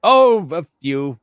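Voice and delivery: high, loud, drawn-out evasive scholar; Doctor Sprack. Acted. Doctor dodging a question on his sciences, pompous character voice. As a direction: stretch it out grandly and evasively.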